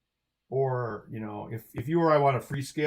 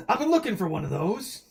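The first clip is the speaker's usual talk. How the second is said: higher-pitched, gasping delight, re-enacted; retold excitement; 6 semitones higher than usual. Telling how he heard about orange cats, re-enacted excitement. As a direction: act out an eager find after a gasp. Retells excited reply after a gasp.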